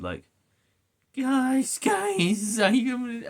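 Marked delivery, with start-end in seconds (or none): 1.2-3.3 high, loud cringey mock voice; mock the character's eager calls in a high pitch; Miles Morales. Gamer imitating a superhero calling his friends, high and cringey. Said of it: "cringe, every word".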